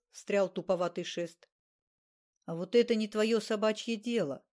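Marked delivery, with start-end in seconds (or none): none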